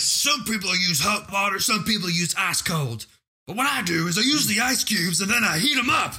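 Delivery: high-pitched, put-on character voice reciting a line; goofy high quote from a film; hammed-up movie character. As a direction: play the rambling eccentric, pitched well up. Acting out the film's ice speech.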